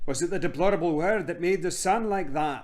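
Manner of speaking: put-on questioning boy. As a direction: ask it as an eager young boy.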